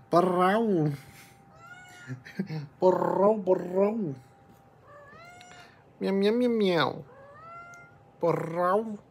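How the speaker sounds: high cat-call imitation; mock meowing. Owner talking to her kitty in high mock meows. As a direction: trill and meow back at the cat.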